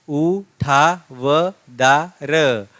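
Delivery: उठावदार stretched very slowly; drawn-out reading. Shown as a demonstration. Teacher reading उठावदार aloud to students, very slowly. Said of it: "आपको ठीक से पढ़ना है उसको".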